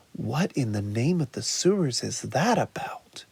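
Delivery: hushed puzzled whisper to himself. Acted. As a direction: whisper it, baffled. Turtle puzzled, talking to himself.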